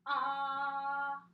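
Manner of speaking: brief open ah for a voice check; short ah. Shown as a demonstration. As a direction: say a short clear ah. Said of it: "just do this for me, say".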